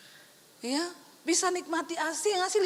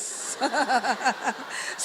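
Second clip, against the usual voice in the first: giggle; 5 semitones lower than usual. Real, not acted. Preacher chuckling about her husband, giggle. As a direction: chuckle at the joke. Laughter at own joke.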